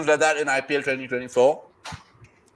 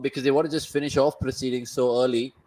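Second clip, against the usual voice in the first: normal talking voice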